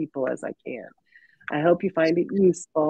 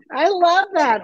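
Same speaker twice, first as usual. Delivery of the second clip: excited; 7 dB louder, slower than usual. Real, not acted. Delighted by guest's descriptor.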